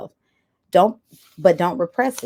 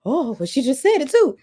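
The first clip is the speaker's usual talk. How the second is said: surprised viewer; 6 semitones higher than usual. Retold or imitated. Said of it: "some of you are like".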